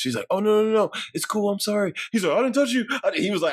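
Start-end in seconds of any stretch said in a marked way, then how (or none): 0.3-1.9 higher-pitched mock backpedalling; hasty apology. Wish Nikki taking over, high apologetic backpedal. She backpedals after freaking out. 2.4-2.9 high-pitched imitated panic; defensive protest. Guy with hands up to her, high mock defensiveness.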